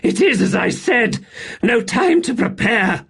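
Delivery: high, loud breathless exclaim; gasping outburst. Acted. Buruk to Seren after the blast, gasping loudly. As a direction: blurt it out, shaken. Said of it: "he gasped".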